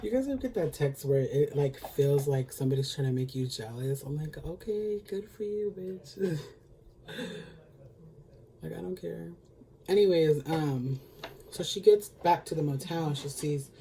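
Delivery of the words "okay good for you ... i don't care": sarcastic dismissal. Pretend. Answering a jealousy text.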